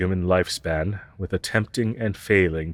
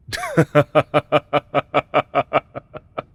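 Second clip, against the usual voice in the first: higher, louder mocking laugh; scornful laugh; 6 semitones higher, 5 dB louder than usual. Alien teacher mocks student wanting to learn, scornful laugh. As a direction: laugh off the very idea.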